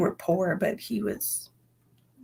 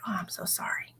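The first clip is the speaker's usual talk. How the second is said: soft, breathy whisper of sympathy; 11 dB quieter than usual. Retold or imitated. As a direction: whisper it gently, like a hushed condolence. Retelling comforting a childhood friend.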